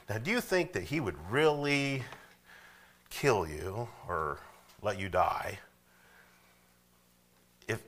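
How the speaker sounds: usual speaking voice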